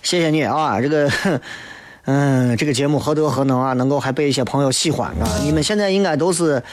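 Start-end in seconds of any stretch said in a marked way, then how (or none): none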